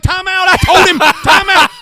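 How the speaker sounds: high, loud retold shout; shouting time out. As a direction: yell to stop the scene. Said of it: "I'm like".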